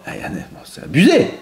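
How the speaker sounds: ordinary delivery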